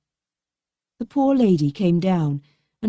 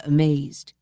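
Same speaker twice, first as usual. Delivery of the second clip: plain reading voice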